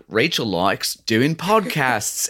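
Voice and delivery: slow, drawn-out Australian accent; Australian accent, slow and charming. Shown as a demonstration. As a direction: say it like an Aussie on the show. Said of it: "you do a good Australian accent".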